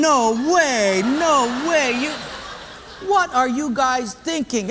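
acted incredulity; disbelieving protest.

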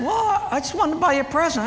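put-on high, earnest child voice; innocent little boy. Young son voiced.

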